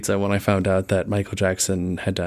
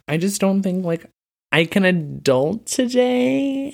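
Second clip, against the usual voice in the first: whiny; 8 semitones higher, slower than usual. Pretend. Callback to whiny intro line.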